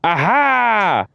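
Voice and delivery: high, loud burst of discovery; triumphant aha. Real, not acted. Player cracking the code, triumphant high aha. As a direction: shout it as the answer clicks into place.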